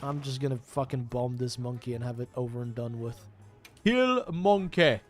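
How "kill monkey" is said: caveman voice. Pretend. Announcing the kill.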